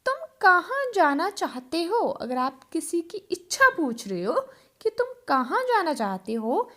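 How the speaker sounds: normal talking voice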